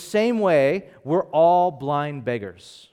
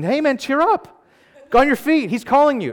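loud, high, hearty mock encouragement; excited calling out; 12 semitones higher, 7 dB louder than usual. Fickle crowd to the blind man, excited calling. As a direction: call him over like a suddenly friendly crowd.

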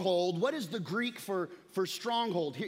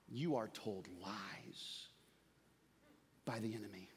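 hushed; 10 semitones lower, 11 dB quieter than usual. Acted. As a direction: drop to a grave murmur. Sudden hushed sermon contrast.

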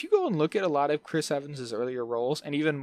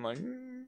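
high mock hum; 11 semitones higher, 12 dB quieter than usual. Imitating old man sound.

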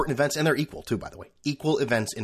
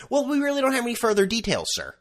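high, prissy support-agent voice; a lightly hammed-up polite agent; mock customer service rep; 11 semitones higher than usual. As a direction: ape the scripted phone rep. PayPal rep answers again.

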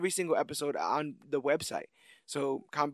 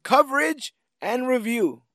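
announcer voice; 9 semitones higher, 6 dB louder, slower than usual. Acted. Grand announcer sign-off.

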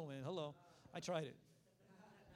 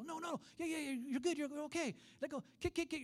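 high, rapid-fire reassurance; reassuring instructor; 9 semitones higher than usual. Retold or imitated. Preacher as teacher letting go of kids, reassuring. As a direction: talk a panicking child through it.

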